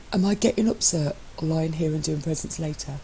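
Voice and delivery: lightly voiced teasing husband; a hint of smug, playful partner; lightly voiced cheeky spouse; Matt's voice. Matt's voice in the reading.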